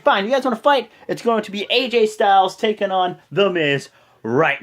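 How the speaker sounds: loud, high announcement; declaring a fight. Retold or imitated. Shane imitated ordering A J to fight Miz, loud. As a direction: imitate a boss laying down the match.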